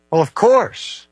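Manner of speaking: playful, high, with a grin; mischievous smile. Real, not acted. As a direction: tease them with a smile in the voice. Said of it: "You can hear the mischievous smile in his voice".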